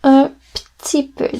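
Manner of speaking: un petit peu with petit said as ptit; everyday French. Shown as a demonstration. Teacher shows students the spoken form. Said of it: "They pronounce it".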